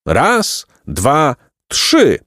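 high, spaced-out count; starter's cry. A narrator's reading. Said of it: "krzyknął namiestnik".

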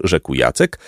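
usual speaking voice

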